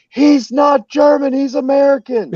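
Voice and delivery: high-pitched imitation of a crowd yelling; reenacted background yelling; retold yelling. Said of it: "They're yelling".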